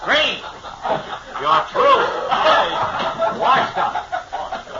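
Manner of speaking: laughing, squeaky high mock firing. Real, not acted. Co-host mock-firing Green, laughing in a high voice. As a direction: crack up while firing him.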